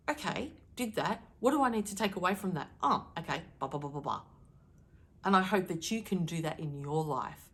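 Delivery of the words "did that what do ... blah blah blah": normal talking voice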